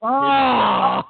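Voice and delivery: sudden shout of shock; loud surprised oh. Real, not acted. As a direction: burst out in surprise. Reaction to the Rush pick.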